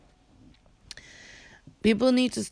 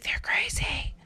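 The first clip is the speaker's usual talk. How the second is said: whispered like gossip; hushed gossip whisper; mock whisper; 5 dB quieter than usual. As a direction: breathe it as a secret. Mocking what gossips say.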